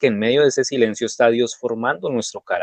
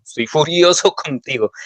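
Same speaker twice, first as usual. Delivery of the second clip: estoy furioso said with a laugh breaking in; mock fury at God with a chuckle; laughing mock anger; 4 semitones higher than usual. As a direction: laugh through the words estoy furioso contigo. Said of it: "en mis oraciones".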